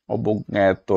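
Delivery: অবজ্ঞাত said as "oboggeto"; careful অবজ্ঞাত, "o-bog-geto"; correct pronunciation. Shown as a demonstration. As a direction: pronounce জ্ঞ in অবজ্ঞাত as gg. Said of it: "প্রকৃত উচ্চারণ কোনটি".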